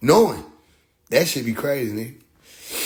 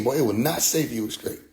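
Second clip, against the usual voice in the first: ordinary talk, nothing marked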